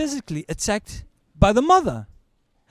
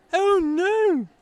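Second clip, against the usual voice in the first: mock gasp; 13 semitones higher than usual. Family's gasp.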